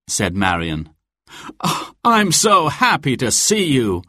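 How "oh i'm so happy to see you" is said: happy cry. A narrator's reading. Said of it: "Robin cried".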